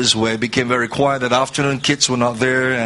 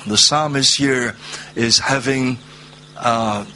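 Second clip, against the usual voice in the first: ordinary delivery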